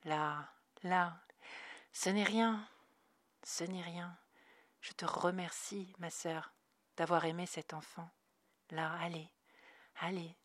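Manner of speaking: soft, quiet soothing. A narrator's reading. Loreley soothing crying Dana, soft quiet voice. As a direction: comfort a sobbing woman gently. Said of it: "de sa voix douce".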